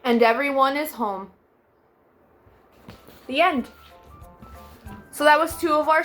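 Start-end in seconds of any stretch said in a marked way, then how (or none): none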